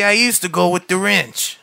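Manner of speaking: lightly put-on Boston twang on wrench; Boston accent. Young man telling his therapist about the wrench, light Boston accent. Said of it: "Should we do it all in a Boston accent".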